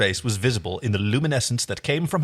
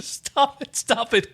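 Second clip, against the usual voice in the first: higher, breathless "stop it" through laughter; laughing protest; 10 semitones higher, much slower than usual. A narrator's reading. John begging them to stop while laughing, higher. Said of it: "cried John, laughing again".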